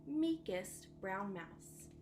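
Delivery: plain reading voice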